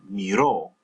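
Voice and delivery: miró stressed on the last syllable, mee-ROH; miró with a strong ending; stress on final syllable. Shown as a demonstration. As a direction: push down on the ó of miró. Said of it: "stress that final syllable as well".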